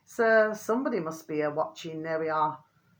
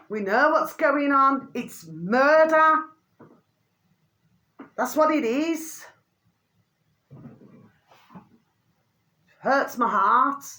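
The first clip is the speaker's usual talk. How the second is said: louder and higher with real upset, slow; raised voice; 6 semitones higher, 5 dB louder, slower than usual. Real, not acted. Vlogger denies shouting at viewers, raised upset voice. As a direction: let the outrage about murder push the voice up. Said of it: "I wasn't shouting at you".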